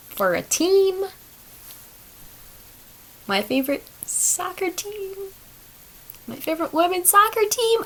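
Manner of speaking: high, softened singsong tease; hint at it in a tiny high voice; coy teasing hint. Real, not acted. Teasing listeners about the favorite team, coy high voice. Coyly hinting at her guest's team.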